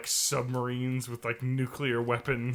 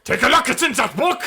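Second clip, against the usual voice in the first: higher and much louder, hammed up; mock shouted jingle; 10 semitones higher, 9 dB louder than usual. Friend shouting the parody jingle at the other. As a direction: belt the jingle like a rally chant.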